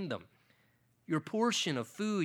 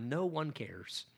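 slow, quieter, each of "no one cares" weighted; underlined words; 4 dB quieter, slower than usual. Shown as a demonstration. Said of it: "I got to underline there on those three words".

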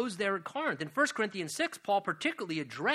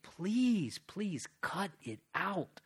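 soft plea; 4 dB quieter, much slower than usual. Acted. Tender plea to congregation.

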